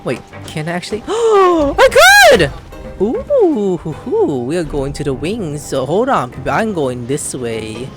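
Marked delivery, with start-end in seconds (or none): none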